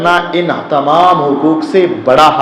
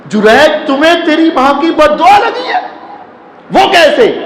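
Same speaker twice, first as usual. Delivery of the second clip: much higher, disbelieving ask; astonished question; 12 semitones higher than usual. Acted. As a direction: sound shocked at what you heard. Crowd astonished at Jurayj's answer.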